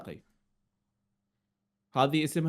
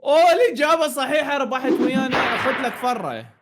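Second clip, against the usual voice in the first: booming prize announcer; 7 semitones higher, 8 dB louder than usual. Acted. Host fakes a right answer.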